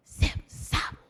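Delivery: breathy whispered chant. Shown as a demonstration. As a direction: whisper it softly to the group. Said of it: "whisper it".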